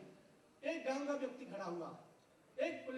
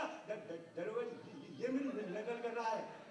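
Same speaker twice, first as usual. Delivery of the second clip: low, soft stutter on द- and न-; stammering villager; 5 semitones lower, 4 dB quieter, slower than usual. Retold or imitated. Villager complaining to the inspector, stammering. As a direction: stumble through दरोगा जी. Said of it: "गांव वाला बोला".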